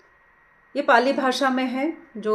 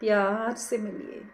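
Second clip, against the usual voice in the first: guided meditation; 4 dB quieter than usual. Acted. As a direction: ease them gently into watching the breath, unhurried. Leads a breath meditation. (धीमी, कोमल ध्यान कराने वाली आवाज़)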